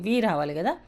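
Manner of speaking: ordinary talk, nothing marked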